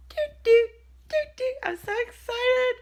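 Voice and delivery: high giddy excitement; excited sing-song. Real, not acted. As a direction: squeal it with joy. Excited about ultrasound.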